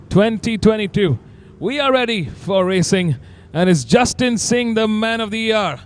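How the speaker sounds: excited. Real, not acted. Commentator hyping the championship leader.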